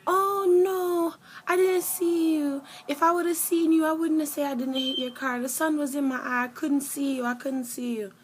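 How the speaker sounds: high, loud whiny excuse voice; mock apology. Mocking the driver's excuses, high and loud. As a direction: whine out the excuses in a squeaky mock voice. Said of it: "She was like".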